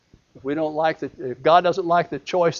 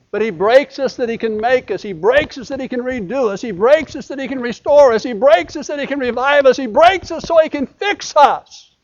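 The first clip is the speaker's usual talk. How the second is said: high, rhythmic preacher's cadence, rising louder; building preaching crescendo; 8 semitones higher, 6 dB louder than usual. Acted. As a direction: build each repeat toward a shout. Preaches at full force, building.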